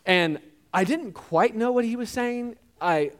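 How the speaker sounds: usual speaking voice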